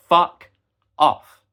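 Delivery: loud, sharp curse; angry outburst. Real, not acted. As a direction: spit it out in anger. Ranting, outburst.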